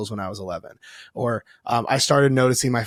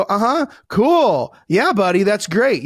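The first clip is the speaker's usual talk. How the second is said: put-on higher, slow absent-minded uh-huh to the kid; distracted dad; 6 semitones higher, 4 dB louder, slower than usual. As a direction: humour the child without really listening. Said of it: "We go".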